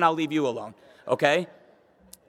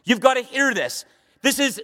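normal talking voice

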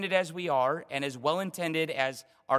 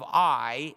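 "I" punched out louder; strong emphatic I; 5 dB louder than usual. Shown as a demonstration. Preacher showing the Hebrew emphasis, strong "I". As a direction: hit "I" with force. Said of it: "it's strong".

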